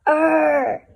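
loud, high exasperated roar; frustrated growl. Acted. Narrator yelling at the reader who turned again. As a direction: let out a frustrated shout.

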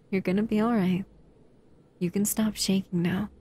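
ordinary talk

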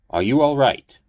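put-on loud, high, worried old priest; alarmed priest. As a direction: call out in concern.

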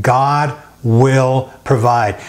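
God will provide, said slowly and firmly; slow, emphatic. Shown as a demonstration. Pastor leading the congregation in the phrase, slow and emphatic. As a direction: lead the church in it, slow. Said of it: "Maybe you can say that with me".

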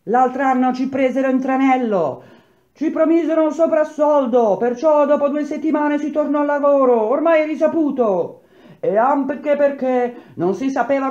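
heated protest. A narrator's reading. Workers defend last year's return to work, high heated protest. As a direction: argue back, pitched high above the crowd.

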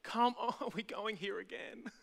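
exasperated telling-off with a laugh; playful reprimand, half laughing; mock scolding. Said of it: "God slap me around and go".

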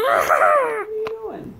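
high, loud, slow cry of alarm; hammed-up panicked shout; mock alarm. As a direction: shriek at the camera-eater. Alarmed at puppet eating camera.

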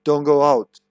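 loud, emphatic warning; exclaimed. Shown as a demonstration. Player rereading Walter's note as a loud exclamation. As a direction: punch it out with exclamation marks. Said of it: "there are two exclamation points there".

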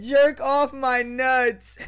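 half-laughed mock squirrel voice; laughing repeat. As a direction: repeat the line cracking up.

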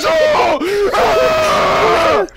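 trapped king panicking in the dungeon, rising yells; panicked cries. Acted. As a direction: yelp as if something is coming for you. Locked in with the dungeon monster.

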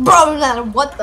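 loud, slow mock outburst; imitating a friend. Showing a friend how their voice scared them, loud imitation. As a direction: mimic the friend, big and drawn out. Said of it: "I don't know, you're like".

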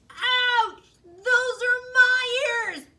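high, loud, slow pained yell; pained holler. Acted. Hare with his ears grabbed, hollering in pain. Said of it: "hollered Hare".

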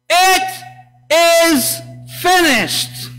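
"It is finished" cried out high and loud, slow and drawn out; loud victorious cry. Shown as a demonstration. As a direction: proclaim "It is finished" like a shout of triumph. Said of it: "That one. The second one".

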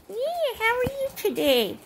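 sweet high voice for the dog; high pet talk. Real, not acted. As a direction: coo at the pet. Talking to a pet.

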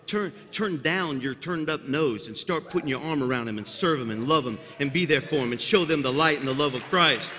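usual speaking voice

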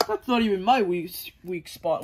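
ordinary delivery

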